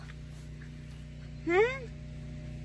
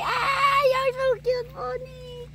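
high, excited squeal of delight; thrilled the pony ate; a real nervous excited squeal; 7 semitones higher than usual. Real, not acted. Pony eats from her hand.

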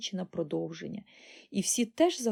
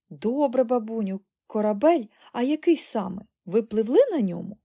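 loud, higher, eager questioning; eager boy; 3 semitones higher, 7 dB louder than usual. A narrator's reading. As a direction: press granny excitedly for answers. Boy eagerly questions his granny.

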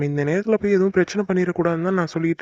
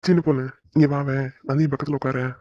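tender coaxing; 4 semitones lower than usual. Acted. Husband gently calling his young wife to sit beside him, tender and low. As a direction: draw her close with a gentle low voice.

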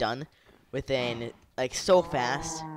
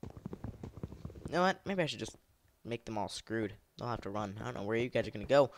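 ordinary talk

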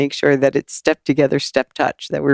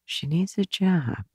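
musing aloud; 6 semitones higher than usual. Retold or imitated. Daughter working out a job for her mom, pondering.

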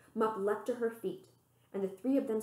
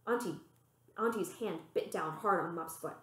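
usual speaking voice

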